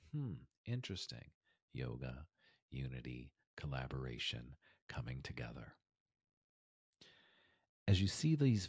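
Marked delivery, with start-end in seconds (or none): 1.7-5.8 lower, softer dreamy guru voice; mock spiritual voice. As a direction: drift into a hushed yoga-teacher tone. Mock dreamy echo of the list.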